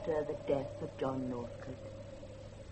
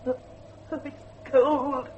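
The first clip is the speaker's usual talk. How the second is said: half laugh, half sob; 5 semitones higher, 3 dB louder, slower than usual. Acted. Girl shivering, laugh and cry heard.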